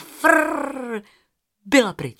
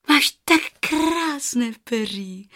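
put-on higher, slow admiring girl voice; little girl; 5 semitones higher, 3 dB louder, slower than usual. Rozárka admires the goose's feathers, higher slow girl voice. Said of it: "řekla Rozárka".

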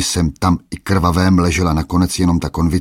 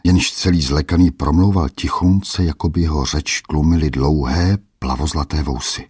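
usual speaking voice

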